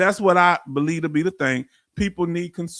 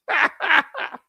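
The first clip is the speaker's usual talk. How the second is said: laugh; 7 semitones higher than usual. Real, not acted. Laughs after joking about spice.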